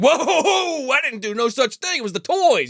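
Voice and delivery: mock panicked denial. Ralph defending himself, retold.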